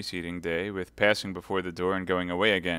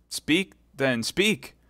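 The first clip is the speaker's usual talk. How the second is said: high, raised-voice demand; urgent cry; 7 semitones higher, 5 dB louder, much slower than usual. Acted. Young officer urging Milady to speak, urgent cry. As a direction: press her impatiently, climbing in pitch. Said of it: "cried the young officer".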